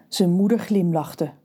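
ordinary delivery, nothing marked

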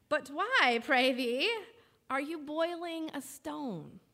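put-on boy's old-fashioned medieval accent; light olde English lilt, slow. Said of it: "in his medieval accent".

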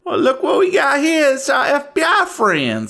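high, hearty deputy greeting; folksy deputy. Acted. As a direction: crow a loud hello like a local deputy. Game master voices local deputy.

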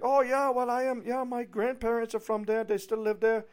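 high, loud mock German accent; German realtor. German realtor hearing of a shared hometown, excited mock accent. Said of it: "tell by the accent she's obviously from Germany".

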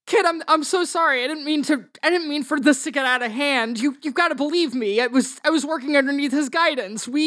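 high, stammering plea; apologetic Doctor Smith. Acted. Game master plays apologetic Doctor Smith.

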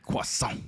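croissant as an airy "kwess-ON"; mock his croissant, "kwess-ON" with the r and t dropped; mock French pronunciation. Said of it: "Who says that?".

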